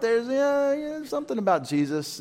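high-pitched, vague mock answer; clueless kid. Voices kids vague about Jesus.

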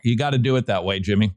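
ordinary talk, nothing marked

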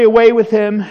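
loud, forceful retold mob shout; angry crowd cry. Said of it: "They cried out angrily".

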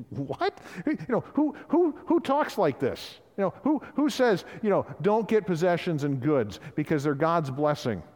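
squeaky high put-on voice of mocking disbelief; mocking Pharisees. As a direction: sneer like a scornful Pharisee laughing at Jesus. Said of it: "the Pharisees are laughing at him".

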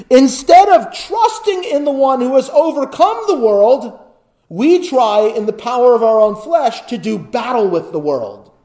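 high, loud preacher's build; preaching at full force. Acted. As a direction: drive it home to the congregation. Preaching climax, rising intensity.

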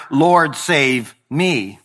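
slow, pleading Lord, save me; desperate plea. Acted. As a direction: beg slowly, each word weighed. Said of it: "he cries out here".

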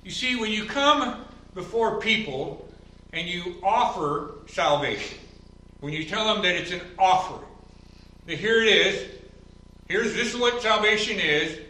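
preaching at full force. Acted. Pastor preaching hard against offered salvation, high and loud. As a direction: drive the point home from the pulpit.

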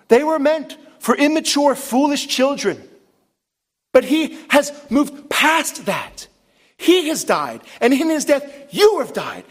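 high, slow, emphatic proclamation; impassioned preaching. Real, not acted. Preacher telling believers they died and rose with Christ, impassioned proclamation. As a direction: drive each phrase home from the pulpit.

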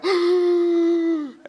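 high, loud cry of a gasp mid contraction; pained gasp. Retold or imitated. Preacher imitating wife in labor at the window, loud gasp. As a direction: gasp as if hit by a labor pain. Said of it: "She's going through a contraction".

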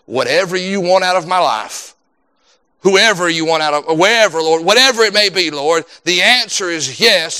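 ordinary talk

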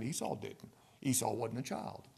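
ordinary delivery

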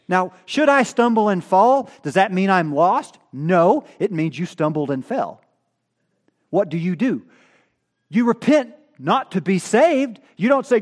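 loud, high pulpit climax; loud rising sermon peak; impassioned preaching. Acted. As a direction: drive it home to the congregation. Preaching at full force.

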